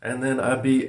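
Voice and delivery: normal talking voice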